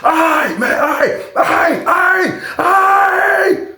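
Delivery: very high pleading yelps, the last stretched out; imitated cries of surrender. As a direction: mimic a pinned man hollering that he gives up. Acts man being subdued by guards.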